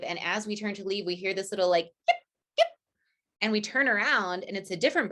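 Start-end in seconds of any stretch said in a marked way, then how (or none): 2.1-2.7 tiny squeaky high yips; puppy yip. Retold or imitated. Calling to the couple, a high puppy yip.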